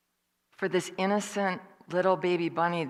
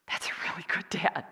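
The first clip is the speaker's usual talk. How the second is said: whispered aside; 4 dB quieter than usual. Retold or imitated. Aside about the dad.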